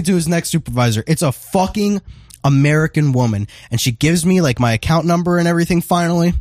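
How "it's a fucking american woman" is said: ordinary talk, nothing marked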